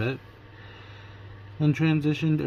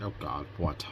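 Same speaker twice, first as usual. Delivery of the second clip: weary dread; 8 semitones lower, 7 dB quieter than usual. Retold or imitated. Dread at being called.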